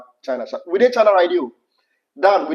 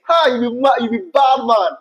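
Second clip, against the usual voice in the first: higher, louder mock scolding in Ghanaian Pidgin; playful Pidgin shout; 8 semitones higher, 4 dB louder than usual. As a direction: tease him loudly in Pidgin. Playful Pidgin teasing of husband.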